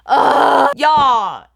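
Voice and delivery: loud, disgusted groan at the snow. Retold or imitated. Storyteller groans to viewers about the blizzard. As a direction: groan it out like you hate the weather. Snowstorm outside.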